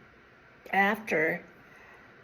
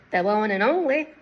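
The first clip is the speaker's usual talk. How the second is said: Southern operator; 2 semitones higher, 4 dB louder than usual. Retold or imitated. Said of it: "she had a Southern voice".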